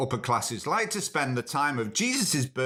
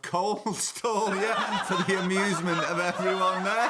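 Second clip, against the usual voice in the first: laughing; 5 semitones higher, slower than usual. Real, not acted. Laughs at the coal stall.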